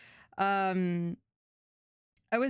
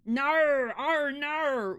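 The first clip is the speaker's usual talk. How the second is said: loud, high mock-Aussie wail; exaggerated, badly faked Australian vowels; bad Australian accent; 6 semitones higher, 7 dB louder, much slower than usual. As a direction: overdo a fake Australian twang. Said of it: "I can't do an Australian accent".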